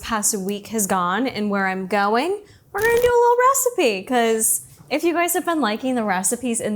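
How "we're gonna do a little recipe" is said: high, fast excited announcement. Real, not acted. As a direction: blurt it out, high and quick.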